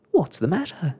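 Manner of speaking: storybook cry of concern. A narrator's reading. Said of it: "cried Snugglepot".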